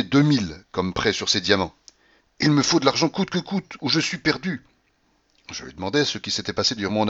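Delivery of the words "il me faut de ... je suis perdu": desperate plea. A narrator's reading. Paulina desperately demands money.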